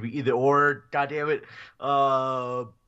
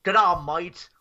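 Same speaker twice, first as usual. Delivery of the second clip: higher-pitched, badly faked Australian accent; 5 semitones higher, 7 dB louder than usual. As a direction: put on a clumsy Aussie accent. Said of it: "I am doing a bad Australian accent".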